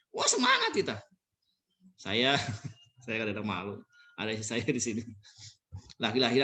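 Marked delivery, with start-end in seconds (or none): 0.1-1.0 much higher burst of cheer; light up all at once; sudden cheer. Retold or imitated. Speaker acting a patient suddenly cheered up, high burst. Sudden enthusiasm after doctor.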